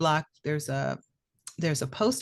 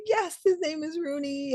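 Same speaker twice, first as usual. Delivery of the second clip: tearful joy; 11 semitones higher than usual. Retold or imitated. Her tearful reply to finder.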